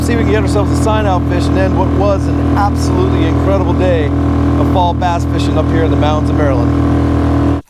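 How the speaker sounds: loud over the roar of the motor; shouting over engine. Real, not acted. Angler calling to viewers over the boat motor, loud. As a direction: call it out above the engine noise.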